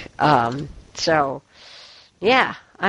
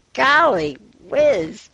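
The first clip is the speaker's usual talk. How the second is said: high, slow, wide-eyed golly whiz; drawn out in real amazement; amazed exclamation; 7 semitones higher, slower than usual. Real, not acted. Book club reader marvelling at the villain: high, slow amazement.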